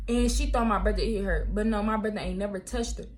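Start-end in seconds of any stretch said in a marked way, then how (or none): none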